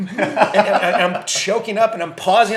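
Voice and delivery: talking through laughter, high and loud; laughing. Real, not acted. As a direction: laugh while admitting it. Laughs recalling choking up.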